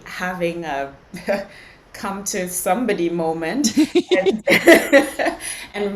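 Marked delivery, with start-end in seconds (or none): 4.5-5.7 high, loud burst of laughter. Real, not acted. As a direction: let a big laugh go. Come to somebody moment.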